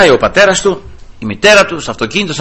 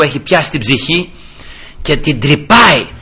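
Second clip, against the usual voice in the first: usual speaking voice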